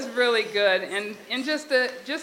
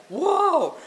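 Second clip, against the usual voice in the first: high, retold whoa of amazement; astonished; 8 semitones higher, 4 dB louder than usual. Spotting something flowing by the car, acted astonishment. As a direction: act out sudden surprise, pitch leaping up.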